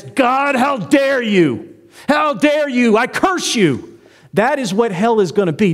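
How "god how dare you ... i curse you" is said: high, slow and defiant, spitting each word; defiant curse. Retold or imitated. Preacher voicing a damned soul cursing God, slow and defiant. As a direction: rage at God, slow and pointed.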